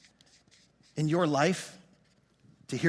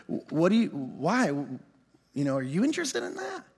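soft, halting, unsure backtrack; hesitant and quiet, rising at the end; retold tentative question; 6 semitones lower, 6 dB quieter than usual. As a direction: feel your way gently into the question. Retold sheepish question after his rant.

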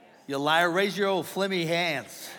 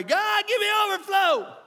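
high, loud, strained cry to God; hammed-up pleading prayer; 5 dB louder than usual. Pastor acting out praying for overflow, hammed-up pleading. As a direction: beg heaven at full pitch, mock-fervent. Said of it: "So we pray".

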